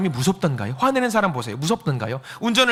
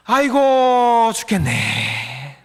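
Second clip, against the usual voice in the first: habitual moan; 4 dB louder, much slower than usual. Acted. Preacher acting his own habitual complaint, moaning. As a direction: wail the first word, then sag slowly. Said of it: "또 다른 말".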